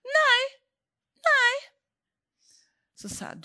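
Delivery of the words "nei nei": high, loud protesting no; loud denial. Retold or imitated. Sister denies in the car, loud and high. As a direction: shout it off, much higher.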